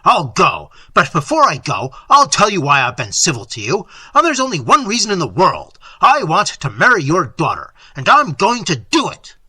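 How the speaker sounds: loud, defiant declaration; heated, resolute outburst; determined anger. A narrator's reading. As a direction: announce it forcefully, daring him to object. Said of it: "menacing him with outstretched hand".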